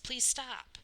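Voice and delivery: high breathless begging; gasped plea. A narrator's reading. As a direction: plead between blows. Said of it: "Cole gasped".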